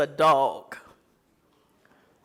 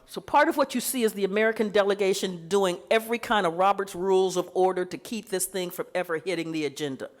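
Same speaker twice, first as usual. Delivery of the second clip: ordinary delivery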